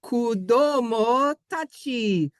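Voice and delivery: careful slow Japanese sayonara; slow drawn-out Japanese. Shown as a demonstration. Saying goodbye to the kids in slow Japanese. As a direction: stretch out each syllable of "Sayonara, kudomo tachi" slowly. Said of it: "do I sound like, um, anime?".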